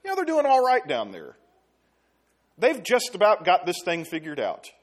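lightly voiced easygoing God; mock satisfaction from God looking down; mock complacent verdict. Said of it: "he doesn't says".

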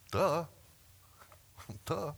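mock duh. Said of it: "Jen's like".

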